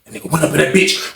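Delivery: ordinary talk, nothing marked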